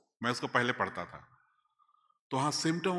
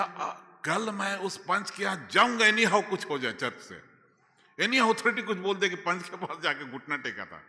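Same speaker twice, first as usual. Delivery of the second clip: rising, louder determined vow; fervent resolve; 7 semitones higher, 4 dB louder than usual. Retold or imitated. Telling the church his vow, fervent and loud. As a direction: swear it with growing fervour. Resolving to go kneel before elder.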